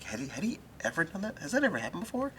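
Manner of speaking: very high-pitched, incredulous retelling; squeaky mock amazement, kept soft; astonished questioning. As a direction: ask in an exaggerated high voice of disbelief. Retold astonished question to colleagues.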